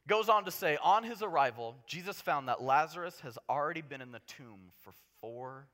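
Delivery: normal talking voice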